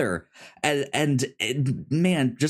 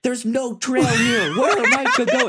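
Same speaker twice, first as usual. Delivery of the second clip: high, louder, laughing protest; exasperated Ethan; 12 semitones higher, 5 dB louder than usual. Retold or imitated. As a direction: whine at Benji through laughter.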